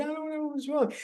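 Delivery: high, soft teary voice, imitating a breakdown; a touch of mock tearful wail; imitated crying. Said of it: "bust out crying and say".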